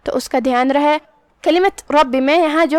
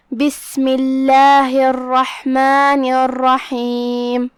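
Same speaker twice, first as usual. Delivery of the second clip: بسم اللہ drawn out with melodic tajweed; measured recitation of بسم اللہ; slow Quran recitation; much slower than usual. Shown as a demonstration. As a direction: chant بسم اللہ الرحمن الرحیم slowly, each syllable lengthened. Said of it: "تو شروع کرتے ہیں۔".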